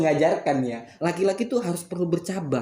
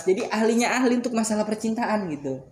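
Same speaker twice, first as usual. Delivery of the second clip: laughing; 8 semitones higher than usual. Real, not acted. Boasting, laughing.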